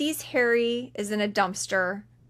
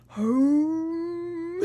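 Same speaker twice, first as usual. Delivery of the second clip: long, high hooting call; mock Bigfoot call, long and high; 7 semitones higher than usual. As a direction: sound it out like a conch shell. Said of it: "it's like".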